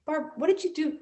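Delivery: lightly voiced school principal; principal's stern question.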